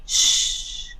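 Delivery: soft unvoiced hush; shushing. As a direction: hush like a secret. Hiding game hush.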